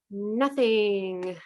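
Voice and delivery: ordinary talk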